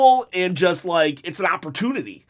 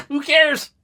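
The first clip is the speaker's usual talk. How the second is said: high, squeaky mock repeat of the question; a jokey cartoonish who cares; silly voice; 9 semitones higher, 4 dB louder than usual. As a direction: toss it off in a goofy high voice.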